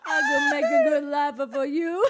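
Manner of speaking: put-on loud hammy Italian accent. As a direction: shout it in a mock Italian accent, laughing. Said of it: "Is this your Australian accent?".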